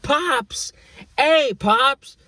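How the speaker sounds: high, bright mock greeting shout; coworkers greeting. Mimicking coworkers who cheerfully greet someone else. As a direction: call out Pops like an excited coworker. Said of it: "they'll be like".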